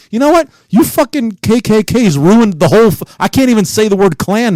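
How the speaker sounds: high, loud mock outburst; sudden rant. Real, not acted. Host ranting at the KKK over the word clan. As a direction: lash out at them.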